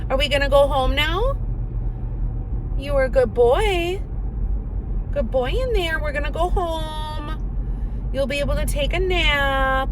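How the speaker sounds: high sing-song pet voice. Real, not acted. Baby talk to the dog.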